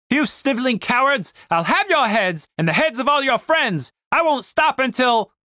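high, blustering captain's tirade; ranting captain, threatening. Acted. Captain threatens the men who soaked him, ranting. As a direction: rant threats in a high, put-on voice.

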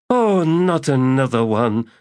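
high, loud weary moan. Acted. Priestess moaning at the magus, high and weary. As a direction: groan at yet another one. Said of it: "moaned Sordico Quam".

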